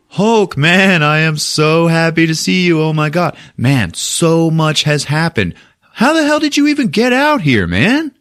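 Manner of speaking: acted high-pitched, gushing reunion; overjoyed greeting. Thor joyfully greets his old friend.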